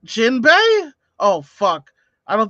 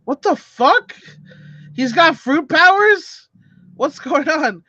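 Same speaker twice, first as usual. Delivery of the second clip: shocked; 8 semitones higher than usual. Real, not acted. Shocked reaction in play.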